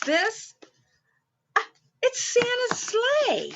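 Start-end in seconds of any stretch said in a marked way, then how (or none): 1.6-3.5 high delighted exclamation; excited discovery. Acted. Showing children Santa's sleigh, delighted. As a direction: gasp with joy at the sleigh.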